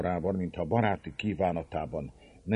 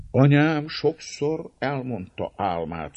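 lost in thought; 8 semitones higher, 6 dB louder, slower than usual. A narrator's reading. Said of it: "mély gondolatokba merülve".

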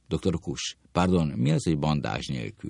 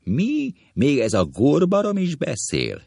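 high, loud indignant shriek; outraged; 10 semitones higher, 4 dB louder than usual. Acted. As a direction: scoff in disbelief. Outraged insult in a quarrel.

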